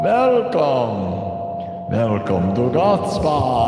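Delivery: slow, stretched vampire welcome; hammed-up vampire count. Vampire count greeting spa guests, drawn-out accent. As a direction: draw out the greeting as a puppet count. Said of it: "the count".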